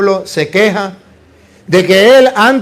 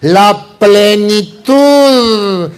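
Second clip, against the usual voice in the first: "La plenitud" drawn out very slowly; slow emphasis; much slower than usual. Shown as a demonstration. Priest asks the congregation to weigh the word, slow. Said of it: "¿Saben lo que significa esa palabra?".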